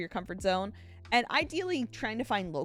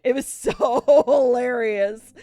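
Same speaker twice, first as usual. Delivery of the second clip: words squeezed out through laughter, slower and louder; laughing; 5 semitones higher, 11 dB louder, slower than usual. Real, not acted. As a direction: still cracking up at it. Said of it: "I was laughing".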